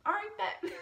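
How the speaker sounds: high, laughing all right; laughing reply. Retold or imitated. Answering the ex's rapping, high and laughing. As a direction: laugh it out, amused. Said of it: "And I'm just like".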